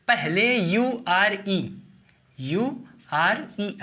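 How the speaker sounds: यूआरई drawn out slowly; slow, emphatic. Shown as a demonstration. Teacher spelling the suffix very slowly. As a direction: stretch यूआरई out for the class. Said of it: "T के".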